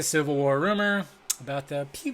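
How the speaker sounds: ordinary talk, nothing marked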